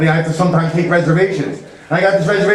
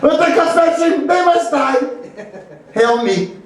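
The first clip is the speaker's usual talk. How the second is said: high, shouted mock dictator rant; Hitler; 11 semitones higher than usual. Comic's Hitler ranting in Yiddish, high shouting. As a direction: rant at the top of the range. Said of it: "Hitler also loved Yiddish".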